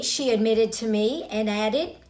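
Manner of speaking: ordinary delivery, nothing marked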